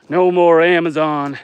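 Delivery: mock lament. Postal strike, dramatic lament.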